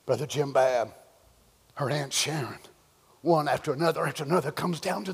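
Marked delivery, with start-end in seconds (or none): none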